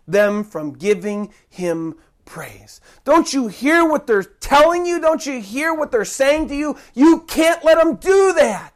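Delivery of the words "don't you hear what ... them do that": rising to a shout; angry demand. Acted. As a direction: push him hard, demanding he stop it. Priests demand Jesus stop them.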